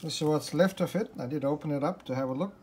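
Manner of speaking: ordinary talk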